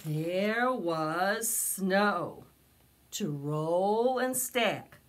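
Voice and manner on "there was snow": plain reading voice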